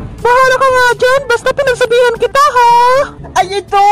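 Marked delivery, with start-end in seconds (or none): 0.0-2.7 high voice, quick final warning; scolding little character. Acted. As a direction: wash your hands of it in a high little voice. Same high character gives up warning. (mataas na boses na nagbababala)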